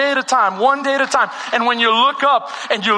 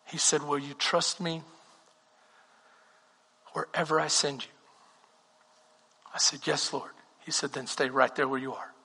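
much softer, lower intimate storytelling; hushed testimony; 7 semitones lower, 8 dB quieter than usual. Acted. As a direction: draw them in close with a quiet confession.

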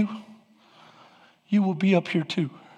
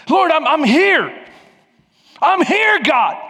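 high, loud, slow protest; grudging shout to God; 8 semitones higher, 4 dB louder, slower than usual. Retold or imitated. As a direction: insist you're here. Acted reluctant prayer.

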